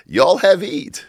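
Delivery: high, loud Southern Yiddish granny; a mock Southern drawl over Yiddish English; Southern Yiddish accent. Grandson recalls great-grandmother feeding him, Southern Yiddish accent. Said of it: "So it was Southern Yiddish".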